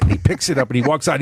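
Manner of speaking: ordinary talk, nothing marked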